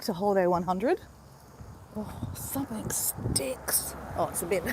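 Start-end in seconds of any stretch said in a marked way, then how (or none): 2.0-4.6 hushed, slow and disgusted; disgusted whisper. Real, not acted. Walking past smelly bins, whispering in disgust. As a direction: whisper it so nobody hears you complain.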